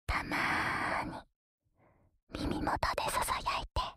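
breathy whisper into the ear. Acted. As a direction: whisper it playfully, close up.

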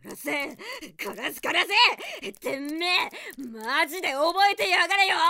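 loud, snarling curses that build to a shout; furious cursing. Acted. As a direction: spit the threats at him through tears. Restrained heroine curses her captor. (泣き混じりに怒鳴る)

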